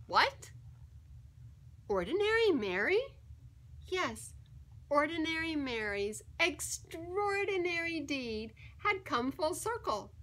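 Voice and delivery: ordinary delivery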